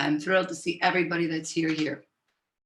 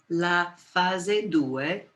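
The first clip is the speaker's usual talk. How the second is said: usual speaking voice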